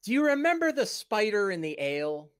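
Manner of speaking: companion character. Acted. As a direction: gently caution a rash friend. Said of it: "and he says".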